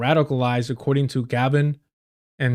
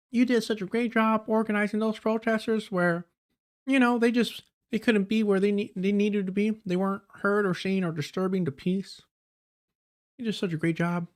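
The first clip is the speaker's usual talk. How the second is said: mock Southern accent; 5 semitones higher, 5 dB quieter than usual. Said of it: "I don't know why he gave her a Southern accent".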